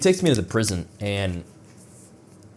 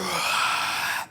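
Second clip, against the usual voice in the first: long unvoiced exhale from the chest; slow demo sigh; breathing out; 9 semitones higher than usual. Shown as a demonstration. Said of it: "Start breathing from here".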